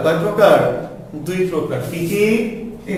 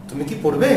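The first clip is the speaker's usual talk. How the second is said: quick short "কি", rising on "পড়বে"; yes-or-no question with unstressed "কি"; 3 semitones lower than usual. Shown as a demonstration. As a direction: pass lightly over "কি", lift "পড়বে". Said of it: "ছোট করে দ্রুত উচ্চারণ করতে হবে".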